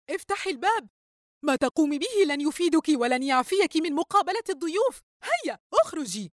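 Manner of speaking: high-pitched shout, not much volume; mock yelling. A narrator's reading. Mother at locked door ordering daughter out, restrained yelling. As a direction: call through the door, high and cross. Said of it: "وهي تصرخ فيها".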